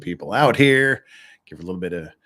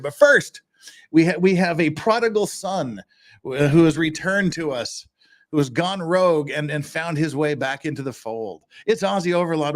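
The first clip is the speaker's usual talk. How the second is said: raised, grand host introduction; announcer intro; 4 semitones higher than usual. Acted. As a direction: build up the guest like a showman.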